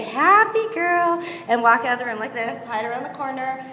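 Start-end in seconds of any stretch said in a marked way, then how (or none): 0.0-1.2 put-on drawn-out cheerful coo to a baby; slow sing-song happy girl; slow sing-song praise. Shown as a demonstration. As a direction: stretch it out sweetly, like praising a toddler. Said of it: "Put 'em in the crib and say".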